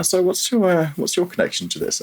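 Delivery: high-pitched, tentative meetup opener; retold icebreaker to a stranger, pitched high; awkward small talk. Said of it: "awkwardly milling around".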